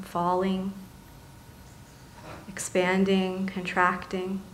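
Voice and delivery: soft, calm meditation voice with long pauses; guided meditation. Acted. Meditation leader settling seated listeners into body and breath, softly guiding.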